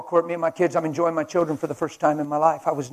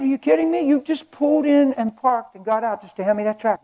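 amazed disbelief; 9 semitones higher, 7 dB louder than usual. Retold or imitated. Stunned by stranger's gesture.

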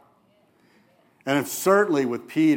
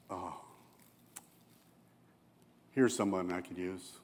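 soft, lower and hushed; quiet tenderness; 6 semitones lower, 12 dB quieter than usual. Retold or imitated. Jesus watching the woman at the well, softly tender. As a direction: murmur it fondly.

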